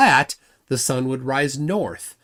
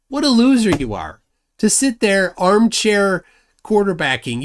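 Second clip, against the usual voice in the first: higher and louder, a jeering jab; louder taunt; 6 semitones higher, 5 dB louder than usual. Acted. Streamer jeering at a flat earther, raised taunting voice. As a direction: throw the insult out loud.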